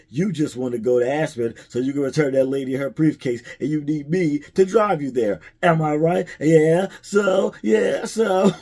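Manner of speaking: excited imitation that ends in giggles; teasing Harry voice. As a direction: play the friend catching him out, climbing in pitch.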